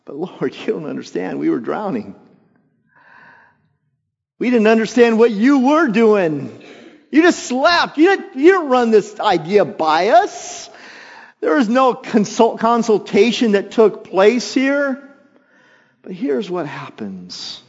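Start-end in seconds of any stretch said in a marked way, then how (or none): 4.4-15.1 high, loud complaining disciple voice; whiny disciples. Acted. Disciples complaining to Jesus, high, loud whining. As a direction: protest like a wronged sidekick.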